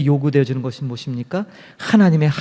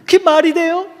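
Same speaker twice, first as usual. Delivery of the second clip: high, indignant challenge to God, much higher pitch; angrier nun; 6 semitones higher than usual. Retold or imitated. Teresa the nun scolding God, high and indignant. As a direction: demand an answer, fed up. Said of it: "이 수녀는 더 화가 났어요".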